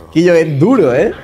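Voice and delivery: ordinary talk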